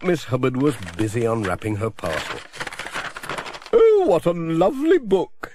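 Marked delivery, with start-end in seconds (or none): 3.7-5.5 higher and louder with delight; thrilled exclamation; delighted cry. Acted. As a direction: gush over the gift. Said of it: "she cried".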